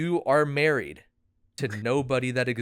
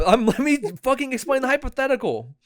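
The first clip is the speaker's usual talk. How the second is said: loud, higher frustrated demand; exasperated yell; 11 semitones higher, 6 dB louder than usual. Real, not acted. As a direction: push through the interruptions, loud. Friend keeps interrupting, laughing.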